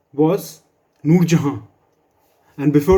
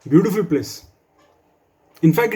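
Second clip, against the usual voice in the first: normal talking voice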